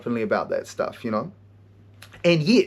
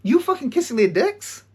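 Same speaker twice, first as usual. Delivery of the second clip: mock outrage; 7 semitones higher than usual. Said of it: "So I'm like".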